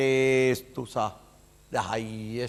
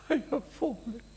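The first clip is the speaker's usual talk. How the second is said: anxious disciple voice; 12 semitones higher, 4 dB quieter than usual. Acted. Disciple wailing he has fallen.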